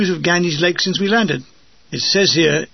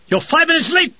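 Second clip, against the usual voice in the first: high, strident military reprimand; Lance Bombardier voice; 11 semitones higher, 3 dB louder than usual. A narrator's reading. Lance Bombardier telling off his late relief, officious. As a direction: pull rank as a petty NCO. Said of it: "in Lance Bombardier voice".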